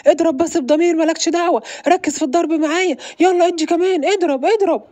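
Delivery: loud, high, fired-up cheering on; excited urging. Acted. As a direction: egg him on to hit harder. Excited urging during fight.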